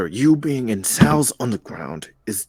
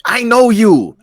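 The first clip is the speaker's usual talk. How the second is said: high, loud I know you; insistent shout; 9 semitones higher, 9 dB louder, slower than usual. Acted. Friend insisting she sees through him, loud. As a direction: push it hard at him.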